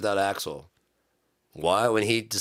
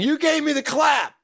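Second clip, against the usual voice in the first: high, loud accusation; outraged yell; 5 dB louder than usual. Pretend. Musician blaming someone for the clap, outraged. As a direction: burst into hammed-up high shouting. Mock rant over a clap sound.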